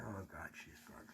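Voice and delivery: hushed and heartbroken; grief-stricken. Retold or imitated. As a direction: let it drop away into quiet grief. Sees girlfriend's body dragged out.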